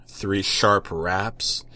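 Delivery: usual speaking voice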